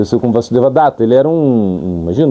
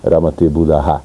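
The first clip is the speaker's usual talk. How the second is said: reciting Sanskrit verse; 6 semitones lower than usual. Acted. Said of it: "Krishna fala".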